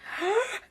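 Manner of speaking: sharp high gasp of delight; big happy gasp; excited gasp. Retold or imitated. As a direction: gasp like you just found treasure. Excited discovery of vegetarian poutine.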